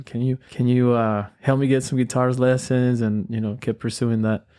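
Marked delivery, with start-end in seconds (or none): none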